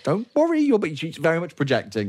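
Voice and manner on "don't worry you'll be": high-pitched fretting reassurance; panicked mum. Acted. Mum, holding back tears, sending her child off: high, fretful reassurance. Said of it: "she's like".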